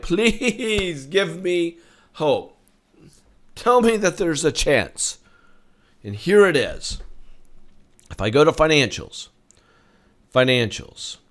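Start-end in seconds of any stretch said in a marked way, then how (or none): 0.0-5.2 high, drawn-out begging as a desperate investor; hammed-up plea, pitched high; mock pleading. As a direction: beg with a long stretched please. Acts out hopeful bulls begging.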